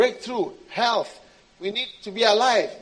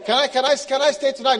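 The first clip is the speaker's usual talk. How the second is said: higher, louder begging plea; pleading believer; 5 semitones higher, 7 dB louder, faster than usual. Acted. Believer begging God to stay, pleading. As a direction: beg to be let in.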